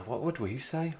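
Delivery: normal talking voice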